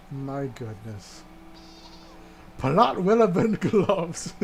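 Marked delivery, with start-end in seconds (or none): 2.6-4.4 slow "Plot relevant gloves" broken by laughter; laughing. Real, not acted. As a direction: giggle through "relevant". Repeating it, cracking up.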